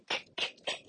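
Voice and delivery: "ک" as separate voiceless clicks; isolated first sound. Shown as a demonstration. Teacher isolating the first sound of a word, sounded separately. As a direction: repeat "ک" short and soft, no vowel after it.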